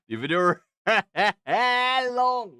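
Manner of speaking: loud, high, laughing emphasis; laughing exclamation. Real, not acted. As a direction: burst out laughing mid-phrase. Repeats it, laughing, much louder.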